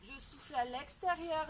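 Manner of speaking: normal talking voice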